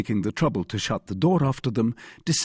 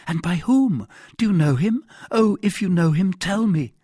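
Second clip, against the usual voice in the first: put-on high, louder, pleading young woman; anxious heroine, urgent; 10 semitones higher, 5 dB louder than usual. A narrator's reading. Abducted wife presses her rescuer to name the abductor, urgent. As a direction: voice her high and eager for an answer.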